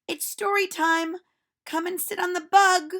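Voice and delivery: put-on a cheerful teacher calling out to children; silly teacher voice. Teacher speaks.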